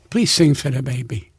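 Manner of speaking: low, contained request; restrained, low-pitched urging; retold polite plea. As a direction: ask politely, keeping the voice down. Wise guy asks, then insists.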